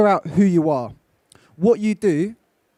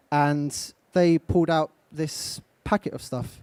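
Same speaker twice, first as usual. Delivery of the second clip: normal talking voice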